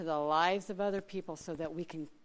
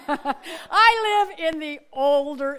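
words pushed out through a real laugh, higher and louder; 10 semitones higher, 14 dB louder, much slower than usual. Real, not acted. Telling the congregation about her old house, laughing aloud.

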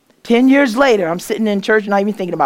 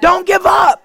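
high, loud ringing shout; preaching at full force; 11 semitones higher, 5 dB louder, slower than usual. Acted. Preacher telling the congregation God's message, shouting.

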